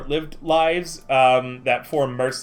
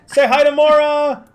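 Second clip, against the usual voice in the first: loud, high call across the room; 12 semitones higher, 8 dB louder than usual. Real, not acted. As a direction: call out so she hears you. Streamer calls out to absent friend.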